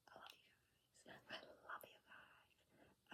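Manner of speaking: faint breathy whisper. Real, not acted. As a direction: barely breathe the words. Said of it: "I'm using my whisper voice".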